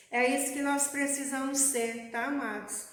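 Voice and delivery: ordinary talk, nothing marked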